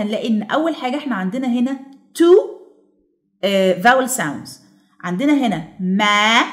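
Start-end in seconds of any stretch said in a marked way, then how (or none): none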